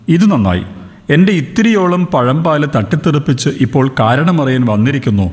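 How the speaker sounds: plain reading voice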